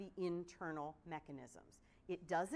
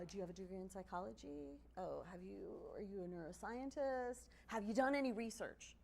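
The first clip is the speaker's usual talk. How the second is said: sarcastic retort. Pretend. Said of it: "I'm smiling 'cause what I wanna say is".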